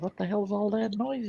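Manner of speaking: put-on a baffled old trader wondering aloud; high, hushed baffled mutter; puzzled Omar voice. Omar hears the ruckus.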